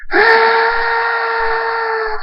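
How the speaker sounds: very high, sustained scream; long scream. A narrator's reading. Thunderstorm screams as mother leaves, long anguished scream. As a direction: scream at the top of the range, holding it. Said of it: "Thunderstorm screams".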